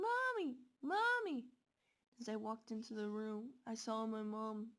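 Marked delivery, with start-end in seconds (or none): 0.0-1.5 high, loud frantic calls; scream for mommy. Retold or imitated. Girl runs toward the screaming room, calling her mom in panic.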